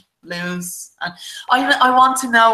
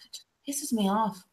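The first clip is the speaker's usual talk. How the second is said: whisper; 10 dB quieter than usual. Real, not acted. Muttering frustration under her breath.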